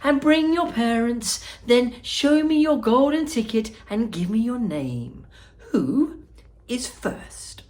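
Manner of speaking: high, fluty host's call; fluty Wonka. Acted. Wonka asking the children for tickets, high and fluty. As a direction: call them up one by one, bright and high. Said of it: "he called out".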